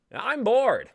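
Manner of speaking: high, loud bored whine; whiny loud complaint. Acted. Actor complaining to the host, loud whine.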